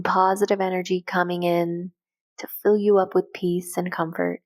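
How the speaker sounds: normal talking voice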